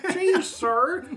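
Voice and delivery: put-on wholesome 1950s kid. Echoing the old-timey kid joke.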